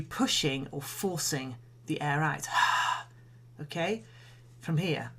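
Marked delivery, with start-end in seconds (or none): 2.5-3.0 slow controlled whispered exhale; steady whispered breath release; breathy sigh out. Shown as a demonstration. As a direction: let the air flow out in a long unvoiced sigh. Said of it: "So I'll demonstrate".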